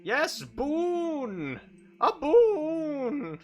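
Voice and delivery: hammy genie offering wishes; excited elemental voice. Acted.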